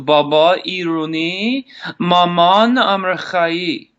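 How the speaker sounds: names said with a native Persian accent. Shown as a demonstration. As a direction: Baba Iruni, Maman Amrakhai in a Persian accent, drawn out at the end. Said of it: "now you have a taste of my Persian side".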